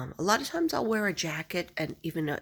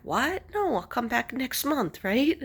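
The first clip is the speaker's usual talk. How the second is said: high, loud, startled questioning; surprised disbelief; 7 semitones higher, 4 dB louder than usual. Retold or imitated. To the beautician who banned her, high and surprised. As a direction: squeak it out in disbelief. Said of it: "I would be like".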